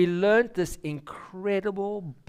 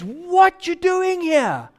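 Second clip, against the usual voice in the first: high, slow, suspicious lady at the door; startled lady; 10 semitones higher, 5 dB louder, slower than usual. Retold or imitated. Homeowner to young book sellers, startled suspicious demand.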